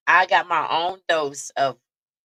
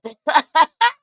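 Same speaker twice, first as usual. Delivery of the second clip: shrieking laugh, higher and louder; high loud burst of laughter; 11 semitones higher, 10 dB louder than usual. Real, not acted. Laughing hard at the aunt's joke about him.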